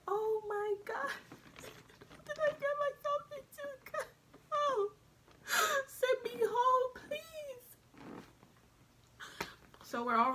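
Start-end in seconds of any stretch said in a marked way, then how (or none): 0.1-7.7 very high, hammed-up whimpering; mock tearful panic. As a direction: wail like a terrified recruit begging for rescue. Acted terrified recruit wanting home.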